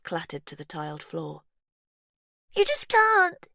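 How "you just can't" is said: much higher, loud scream; furious tantrum scream; child's shriek. A narrator's reading. Faith screaming at her father, furious.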